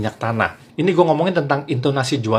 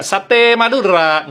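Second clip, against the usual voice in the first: slow, high, loud "Sate Madura"; wrong satay call; 5 semitones higher, 5 dB louder, much slower than usual. Shown as a demonstration. How Madura sellers don't call, drawn-out high cry. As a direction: stretch it like the Padang cry. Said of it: "nggak begitu tuh".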